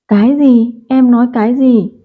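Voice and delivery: put-on stunned sister questioning the boy; shocked. A narrator's reading. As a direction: react as if the news hits hard. Shocked at brother's beating.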